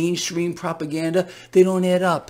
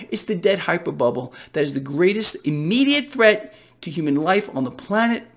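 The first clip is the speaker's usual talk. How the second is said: ordinary talk, nothing marked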